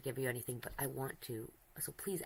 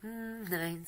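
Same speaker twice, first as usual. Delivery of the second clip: contented hum, pitched up; happy sigh; 7 semitones higher, 3 dB louder than usual. Real, not acted. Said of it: "I'm just sighing in happiness".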